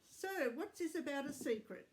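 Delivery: usual speaking voice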